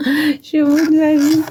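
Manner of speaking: laughing, exhausted. Real, not acted. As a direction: laugh through the tiredness. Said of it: "Je suis crevée par ma séance". (en riant, épuisée)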